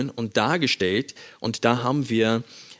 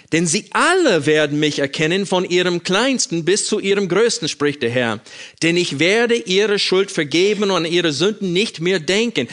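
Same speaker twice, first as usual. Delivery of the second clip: raised, louder preaching read of the verse; fervent scripture reading; 5 semitones higher, 4 dB louder than usual. Acted. Preacher reading the new covenant verse to the church, fervent. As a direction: proclaim the promise with force.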